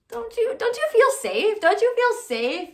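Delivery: high, mocking sing-song question; sarcastic taunt. Mocking the viewers with a sarcastic question. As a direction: needle them with fake concern.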